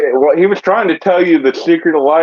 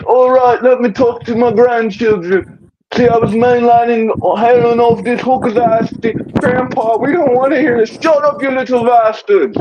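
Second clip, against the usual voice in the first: high-pitched rocker grandpa impression; 7 semitones higher than usual. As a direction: ramble up high as a cranky druggie grandad. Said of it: "do a show where he goes".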